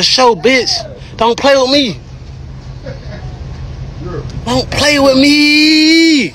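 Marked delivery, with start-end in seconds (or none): 1.2-6.3 taunting warning. Real, not acted.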